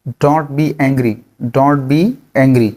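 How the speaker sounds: each word stretched slowly; slow and clear, twice over. Shown as a demonstration. Teacher slowly repeats the phrase for learners. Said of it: "गुस्सा नहीं करें".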